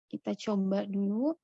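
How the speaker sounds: ordinary delivery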